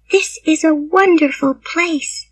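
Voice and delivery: put-on soft, high child's voice; delighted little girl's thought. A narrator's reading. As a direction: marvel softly to yourself as a girl.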